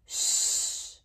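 long unvoiced hiss; hissed shush. A narrator's reading. Crowd silencing itself for Gobber, hissing. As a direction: shush like sea snakes. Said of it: "hissed the crowd like sea snakes".

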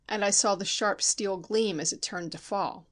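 plain reading voice

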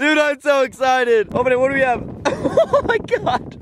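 high, thrilled gushing over the guns; can barely contain it; squealing excitement. Real, not acted. Opening the gun cases for the first time, high and thrilled.

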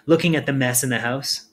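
ordinary delivery, nothing marked

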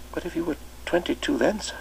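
put-on shy new boy asking at the door; timid small boy. Boy puzzles.

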